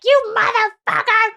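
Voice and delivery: hammed-up curse at the cheater; mock furious. Angry girlfriend cursing her absent boyfriend, mock furious. Retold angry phone messages.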